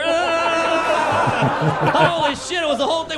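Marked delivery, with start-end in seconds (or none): 0.0-1.9 high, long mock howl; drawn-out electrocuted yell, acted; imitated tased scream. Police tase the attacker, imitated tased scream.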